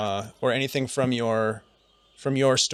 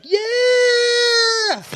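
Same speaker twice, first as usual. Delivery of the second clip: much higher, louder whooping "Yeah!"; ecstatic yell; 5 dB louder than usual. Retold or imitated. GM voices Runar flying high on energy, whooping yell. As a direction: let out a wild joyful whoop. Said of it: "just like".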